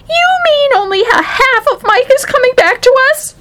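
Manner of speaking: very high shrieking mother; piercing falsetto panic; horrified cry. A narrator's reading. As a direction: cry out in horror, pitch right up. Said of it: "she cried".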